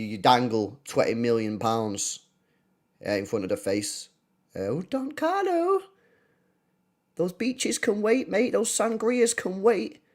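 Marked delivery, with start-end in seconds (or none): 4.6-10.0 high, slow coaxing sweet-talk; coaxing pitch. Pretend. Fan sweet-talking Don Carlo to drop retirement, high slow coaxing. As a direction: tempt him out of retirement, slowly.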